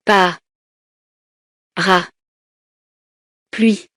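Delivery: "Pa. Ra. Pluie." in three isolated syllables with long pauses. Shown as a demonstration. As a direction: count it out: "Pa", stop, "Ra", stop, "Pluie". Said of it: "Je frappe les syllabes". («Pa. Ra. Pluie.» découpé syllabe par syllabe)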